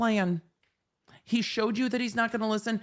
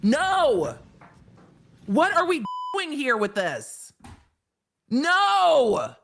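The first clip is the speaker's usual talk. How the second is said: loud, raised cries of protest; exasperated yelling; 6 semitones higher, 4 dB louder, much slower than usual. Real, not acted. Streamer protesting the judge's plan, exasperated yelling. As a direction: shout NO at the screen in disbelief.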